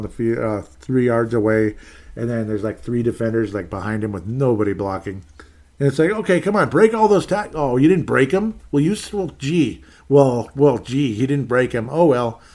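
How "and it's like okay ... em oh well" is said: sarcastic mockery. Mocking his own team's failed play.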